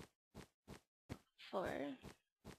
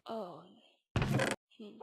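normal talking voice

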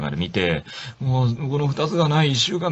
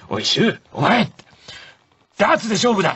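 higher hammed-up challenge; mock challenge; 10 semitones higher than usual. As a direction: throw down the gauntlet, hammed up. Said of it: "って言うから".

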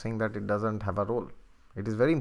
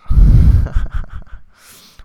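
loud hearty laughter; big laugh; 16 dB louder than usual. Real, not acted. Astrologer laughing hard at her own sarcasm, loud laugh.

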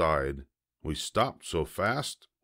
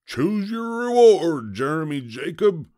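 grinning pirate; 10 semitones higher, 6 dB louder than usual. A narrator's reading. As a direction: proclaim a reward with a pirate's grin. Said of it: "opened the chest and grinned".

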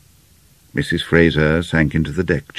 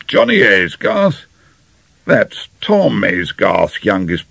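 put-on blustering old General; 6 semitones higher, 4 dB louder than usual. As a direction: muse aloud like a bluff General. General's character voice.

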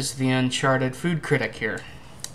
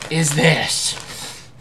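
slow, drawn-out, louder disbelief; surprised disbelief; 3 semitones higher, 6 dB louder, much slower than usual. Real, not acted. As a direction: stretch it out in amazement. First sight of odd flavor.

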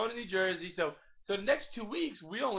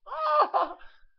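big high burst of laughter; loud laugh; 10 dB louder than usual. Real, not acted. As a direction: howl with laughter. Dad keeps laughing at joke.

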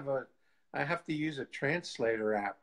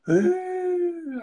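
high, loud mock wail of a crying woman; a hammed-up sobbing ooh; imitated crying wail; 7 dB louder than usual. Retelling how the woman burst into tears, mock wail. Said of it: "she was crying and crying".

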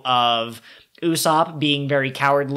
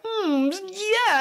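fresh Frankie. Retold or imitated. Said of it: "he was like".

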